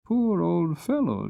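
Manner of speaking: put-on high, slow child's voice full of pity; a boy pitying a worn-out horse; boy pities the old horse, high and slow; young boy. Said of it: "said the boy".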